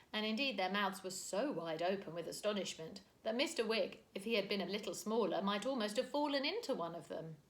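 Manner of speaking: usual speaking voice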